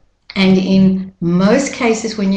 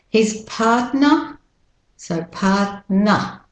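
slow British Australian "partner", schwa ending; British Australian pronunciation; 2 semitones higher, slower than usual. Shown as a demonstration. Teacher breaks down partner, slow British Australian accent. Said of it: "the British Australian first".

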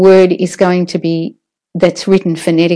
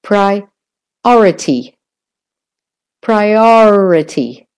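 slow "priority" with a long OR; stress on OR; much slower than usual. Shown as a demonstration. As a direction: stretch the second syllable of "priority". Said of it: "or in priority is the stressed syllable".

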